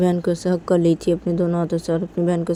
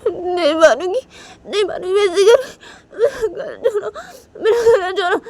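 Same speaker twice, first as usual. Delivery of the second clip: much higher, crying out in protest; 13 semitones higher than usual. Retold or imitated. Shanaya crying at Tisha while hitting her, sobbing. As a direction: sob it out while hitting back. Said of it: "बोलने लगती है".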